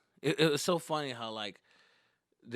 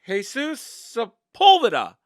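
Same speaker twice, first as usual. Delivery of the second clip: slow, very loud, high announcing voice; confused teacher announcing; 9 semitones higher, 15 dB louder, slower than usual. Retold or imitated. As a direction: call the name out slowly and doubtfully. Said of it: "teacher that was announcing it was confused".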